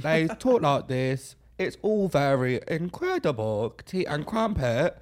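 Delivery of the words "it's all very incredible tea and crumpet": slow, exaggerated fake British; bad American-doing-British accent. Mocking Americans who try British, slow fake British accent. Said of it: "when American people try to do a British accent".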